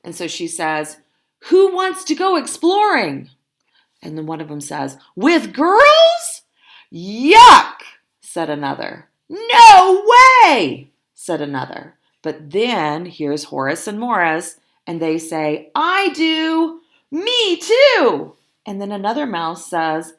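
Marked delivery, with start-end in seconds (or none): 1.5-3.3 eager call. Acted. Dolores calls to the boys. 5.1-7.8 put-on high, loud, boyish disgust; disgusted yuck. Boys reject girls. 9.3-10.9 high, loud refusal dragged out; flat refusal. Acted. Another boy refuses. 15.7-18.4 eager yes. Acted. Horace and Morris volunteer.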